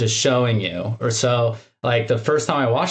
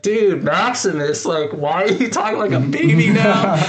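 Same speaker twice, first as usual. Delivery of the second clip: mock bewilderment; 5 semitones higher than usual. Host imagining himself asking Maximus, mock bewilderment. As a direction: question him in playful disbelief. Imagined viewer questioning the character.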